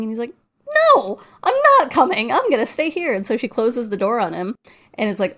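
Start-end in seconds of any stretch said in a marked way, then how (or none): 1.0-3.1 high, loud balking; mock panicked refusal. Chip refusing to leave the elevator, mock panicked refusal. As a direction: refuse loudly in a raised, hammed-up voice.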